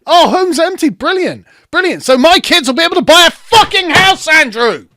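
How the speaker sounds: shouting high and loud in real anger; furious shout at the interviewer; angry outburst. Real, not acted. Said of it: "So I got quite angry there".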